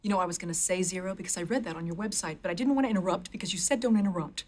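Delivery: fast, rushed explanation; nervous babbling. Acted. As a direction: rattle it out without stopping. Nervous babbling to stern trainer.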